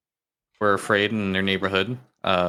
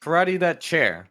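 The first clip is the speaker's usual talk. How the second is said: commanding the dog; 7 semitones higher, slower than usual. Acted. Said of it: "and he says".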